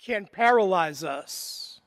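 solemn preaching. Acted. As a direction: preach it slowly, leaning on fear. Opening of the homily.

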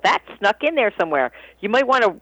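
ordinary talk, nothing marked

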